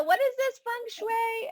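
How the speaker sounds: high, puzzled question; surprised. Retold or imitated. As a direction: ask it in high mock bewilderment. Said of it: "I know".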